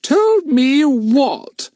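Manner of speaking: slow, pointed puzzled question; impatient. A narrator's reading. Fatty's mother demanding an answer, slow and impatient. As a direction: drag it out, losing patience.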